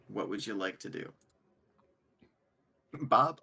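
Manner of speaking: ordinary delivery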